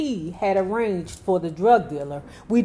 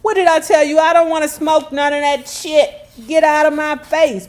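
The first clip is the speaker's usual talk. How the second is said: loud, pitched up, fed up; angry refusal; 6 semitones higher, 7 dB louder, faster than usual. Retold or imitated. Grace yelling at the pushy friend to back off. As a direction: Grace shoving him off with a loud snap. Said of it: "And Grace was like".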